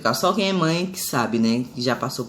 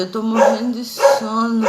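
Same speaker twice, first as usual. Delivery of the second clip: drowsy; 7 semitones higher, slower than usual. Retold or imitated. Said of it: "eu fiquei grogue da anestesia".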